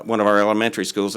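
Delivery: ordinary delivery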